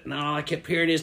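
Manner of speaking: ordinary talk, nothing marked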